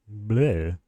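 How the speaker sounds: light cartoon vampire "bleh"; vampire impression. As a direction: toss off a quick vampire bleh. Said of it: "vampire impression?".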